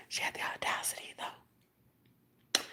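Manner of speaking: hushed, voiceless outrage; whisper. Real, not acted. Outraged at her audacity, whispering.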